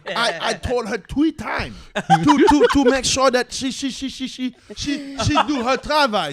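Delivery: put-on high, loud, laughing Haitian accent; stuttering Haitian parent voice. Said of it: "I'm gonna do the Haitian accent".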